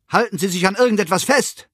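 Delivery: urgent high-pitched cry; shouted warning. A narrator's reading. Man shouts to passenger just before the collision, crying out. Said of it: "schrie mir ein Mann zu".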